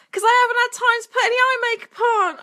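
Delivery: fast, high rushed excuse. Real, not acted. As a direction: blurt it out quickly.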